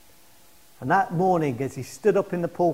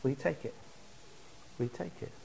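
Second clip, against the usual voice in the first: very soft, pausing invitation; hushed appeal; 5 semitones lower, 17 dB quieter, faster than usual. Real, not acted. Preacher inviting congregation to forgiveness, hushed appeal. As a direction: let each question fall almost to nothing.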